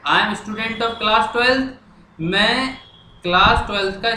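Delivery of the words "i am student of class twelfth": slow and higher, word by word; slow, raised dictation. Shown as a demonstration. Teacher dictating the letter to class twelve students, slow and raised. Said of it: "अब मैं लिखना शुरू कर रहा हूँ".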